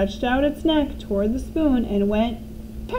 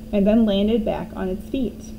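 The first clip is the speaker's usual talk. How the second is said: usual speaking voice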